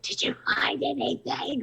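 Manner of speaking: unvoiced urgent whisper-shout; whisper yelling. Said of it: "they're, like, whisper yelling".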